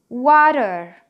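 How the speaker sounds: American WATER as 'wa-rer', tapped t; loud American water with a flapped middle; American accent. Shown as a demonstration. Teacher gives the second American option, American 'wa-rer'. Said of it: "الامريكان اكسنت بتاعت الورد دي بتقول".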